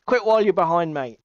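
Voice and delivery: usual speaking voice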